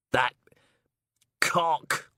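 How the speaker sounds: angry outburst. Acted. Steele swears at a rival.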